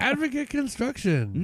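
slow, high announcer read. Acted. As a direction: announce the sponsor grandly. Mock sponsor read.

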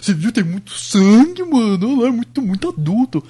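hammed-up kid marveling at gore; mock twelve-year-old. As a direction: play an awed young teen gushing. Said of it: "a galera de doze anos fala".

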